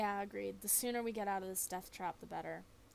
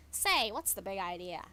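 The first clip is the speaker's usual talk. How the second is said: loud, cranky little bat voice; a woken bat barking at the light; grumpy bat shouting at the flashlight; annoyed bat; 9 dB louder than usual. Acted.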